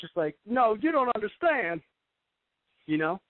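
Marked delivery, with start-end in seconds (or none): 0.5-1.8 high, slow, preachy protest; mock preacher pleading, higher and slower; over-the-top preacher. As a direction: ham it up like a revival preacher. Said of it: "an over-exaggerated preacher".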